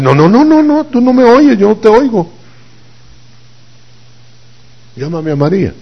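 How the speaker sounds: very high, fussy string of no's; high-pitched refusal. Acted. The Lord turns Martha away and asks for Mary, high-pitched refusal. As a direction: wave them off in a high put-on voice.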